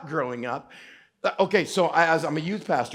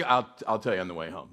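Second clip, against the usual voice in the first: low, softened voice to a buddy; hushed-down private promise; mock confidential aside; 12 semitones lower, 5 dB quieter, faster than usual. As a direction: put it off discreetly, voice dropped.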